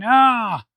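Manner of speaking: drawn-out, high "ah" rising then falling; mock cry. Reviewer voicing a comic panel, mock high cry. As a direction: put on a comic-book yelp.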